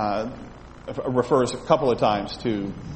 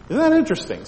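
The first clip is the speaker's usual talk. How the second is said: much higher pitched, eager question; delighted; 10 semitones higher, 3 dB louder, much faster than usual. Real, not acted. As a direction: share the fun fact with delight. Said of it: "delighted aside".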